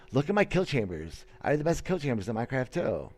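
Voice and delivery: ordinary talk, nothing marked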